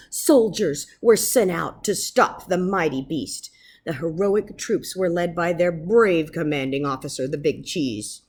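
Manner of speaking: usual speaking voice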